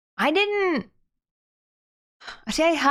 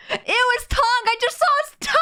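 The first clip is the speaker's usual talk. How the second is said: much higher shrieking disgust; horrified shriek; 13 semitones higher, faster than usual. Real, not acted. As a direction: squeal in horror. Horrified at seeing tongue.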